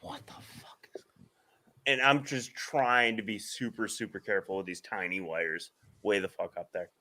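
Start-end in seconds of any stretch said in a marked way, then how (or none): 0.0-1.0 whispered what the. Retold or imitated. Muttering alone up on the ladder.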